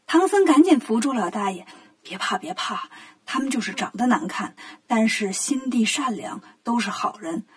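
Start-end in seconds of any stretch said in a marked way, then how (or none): 2.0-7.4 soft, lower, soothing voice; gently calm a frightened listener; reassuring. Acted. Tang Seng soothes the old man about his disciples, soft and reassuring.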